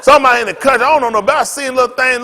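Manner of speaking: ordinary talk, nothing marked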